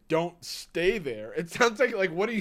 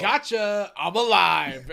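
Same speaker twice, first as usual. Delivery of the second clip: loud, gleeful reveal; spring a surprise on a bear; playful shouted gotcha; mock triumph; 3 semitones higher, 8 dB louder, much slower than usual.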